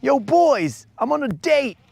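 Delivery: high mock bro voice; buddy voice. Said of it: "he just popped in to let us know".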